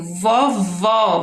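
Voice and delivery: "Vovó" by syllables, both vowels open ó; open O sound. Shown as a demonstration. Teacher demonstrating acute accent, "Vovó" split and open. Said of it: "é um som aberto".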